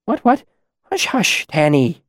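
high-pitched soothing patter; gentle hushing. A narrator's reading. Trappist calming Tanny in his cot, gentle hushing. Caretaker soothing a noisy child.

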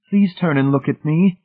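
high-pitched, urgent entreaty; begging. A narrator's reading. Wife begging her husband to look at her, pleading. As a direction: implore them, voice lifted high. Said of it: "Marcia begged".

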